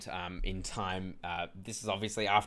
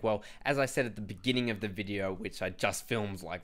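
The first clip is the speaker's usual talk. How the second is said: usual speaking voice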